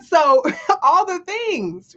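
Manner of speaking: amused, slow, high laughing delivery. Real, not acted. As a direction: let a laugh carry the phrase out. Laughing while listing things.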